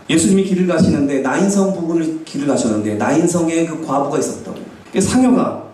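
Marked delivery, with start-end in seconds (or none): none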